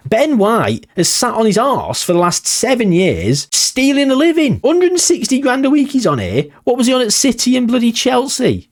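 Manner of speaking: high-pitched, incredulous football rant; indignant rant. Real, not acted. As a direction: rail at him in rising disbelief. Indignant rant about an idle player.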